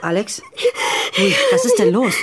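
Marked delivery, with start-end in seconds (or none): 1.1-2.1 much higher, louder frightened cry; alarmed. Acted. Charlie alarmed as Alex collapses. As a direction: rush to her in shock. Friend suddenly breaks down.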